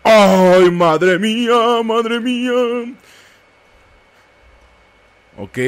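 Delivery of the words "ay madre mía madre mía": loud, high, drawn-out wail of alarm; alarmado. Real, not acted. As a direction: wail it out high, stretching each word. Panicked reaction to sudden game action. (lamento agudo de alarma)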